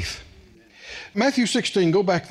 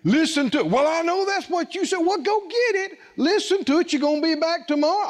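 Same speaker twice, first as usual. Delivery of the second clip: higher, animated back-and-forth; lively retold argument; 7 semitones higher than usual. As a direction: play both sides of the quarrel with gusto. Retold argument, both voices.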